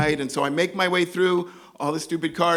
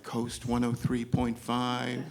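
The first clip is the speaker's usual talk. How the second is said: low, soft, slow station ID; hushed easy-listening announcer; soft radio station; 5 semitones lower, 7 dB quieter, slower than usual. Acted. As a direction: drop into a mellow late-night radio read. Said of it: "like radio stations".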